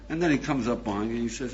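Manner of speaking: ordinary delivery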